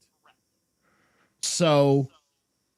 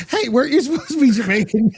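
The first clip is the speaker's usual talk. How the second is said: teasing mock question; 6 semitones higher than usual. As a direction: poke fun at him, laughing. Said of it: "Kofi lost his Jamaican accent".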